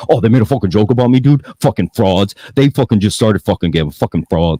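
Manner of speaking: fast, loud whiny mock rant; hammed-up sulky complaining, rattled off fast; mock-whine of a rival who feels insulted; mocking Daver. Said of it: "has to come out here and be like".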